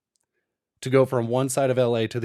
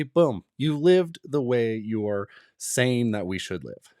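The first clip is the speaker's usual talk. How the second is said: usual speaking voice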